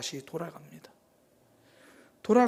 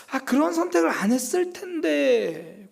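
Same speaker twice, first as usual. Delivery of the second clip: regretful cry; 8 semitones higher, 6 dB louder than usual. Retold or imitated. Peter's regret voiced.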